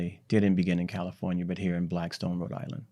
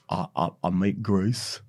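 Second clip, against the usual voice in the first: put-on a light, hammy Southern London accent as a disguise; a hint of a Southern London accent, half-joking. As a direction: play a pig farmer with a mild Southern London accent. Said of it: "he put on his Southern London accent".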